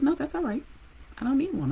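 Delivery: put-on girl proudly refusing the extra lollipop; proud kid. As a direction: turn it down proudly.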